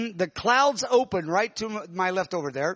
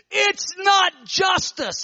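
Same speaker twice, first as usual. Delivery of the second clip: a much higher, slow, anguished shout on not; screaming out; 11 semitones higher, 4 dB louder, much slower than usual. Retold or imitated. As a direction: cry it out in protest. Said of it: "I start screaming out".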